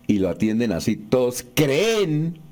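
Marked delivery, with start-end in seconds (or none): 1.5-2.4 creen with a higher, drawn-out final E; acento agudo final. Retold or imitated. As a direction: lean on the last E of creen: creÉN. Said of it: "también con acento agudo en la E final". (creen alargado, con acento agudo en la e final)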